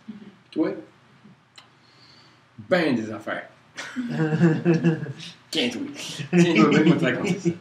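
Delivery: half-hearted, dismissive reply; mock disinterest. Shown as a demonstration. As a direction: brush the question off playfully. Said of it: "À moins du genre". (réponse désintéressée, pour rire)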